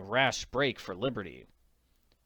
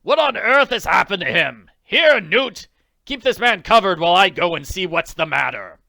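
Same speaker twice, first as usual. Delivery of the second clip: loud, higher, annoyed shouting; irritated orders; 7 semitones higher, 13 dB louder than usual. Acted. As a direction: snap out the orders, rattled. Angry leader calls out orders.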